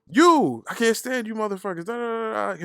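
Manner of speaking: high-pitched imitation of her complaint; mock ranting. Host imitating his homegirl complaining, high mock rant. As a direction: burst in high, then rattle on.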